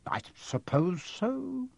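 slow, soft, high and stiff; drawn-out, grudging agreement. A narrator's reading. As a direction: concede reluctantly in a high, prim voice. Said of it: "said Missus Dursley stiffly".

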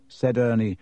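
usual speaking voice

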